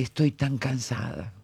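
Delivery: soft, understated retold exhaustion; weary echo. Speaker recalling the exhausted mother's words, weary echo. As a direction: echo her tired words quietly, with understanding. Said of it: "era ese otro cansancio".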